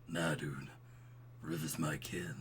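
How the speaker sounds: low, soft bro voice; smooth and buttery. Acted. Proud old roommate explaining the baby, smooth low voice. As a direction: keep it deep and easy. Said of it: "I can do smooth and buttery".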